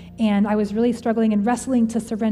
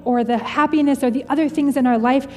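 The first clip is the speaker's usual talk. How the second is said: impassioned preaching; 4 semitones higher than usual. Real, not acted. Speaker urging listeners to trust Jesus, impassioned preaching. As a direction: build it up like a pastor at full force.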